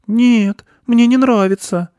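put-on very high, loud girl's voice; refusing girl. A narrator's reading. Girl tells the goat she dislikes it, high protest. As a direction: refuse loudly in a high little voice.

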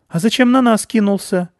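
put-on high, loud indignant girl; a small girl scolding the boar; piping demand; little girl accusing.

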